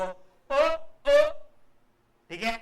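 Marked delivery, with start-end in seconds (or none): 0.0-1.2 "ओ", clear gap, "ओ"; two distinct sounds. Shown as a demonstration. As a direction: say each "ओ" short and separate. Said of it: "तो दो distinct सुनाई देगा". (दो अलग, साफ़ "ओ")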